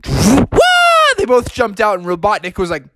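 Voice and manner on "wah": battle cry. Acted. Heroes leap out attacking.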